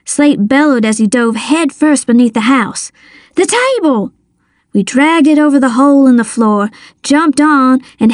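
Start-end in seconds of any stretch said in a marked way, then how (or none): 3.4-4.1 high, sharp exclamation; urgent call. A narrator's reading. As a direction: call it out in the scramble. Urgent call during the attack.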